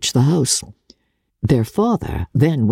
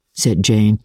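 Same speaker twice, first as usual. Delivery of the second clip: usual speaking voice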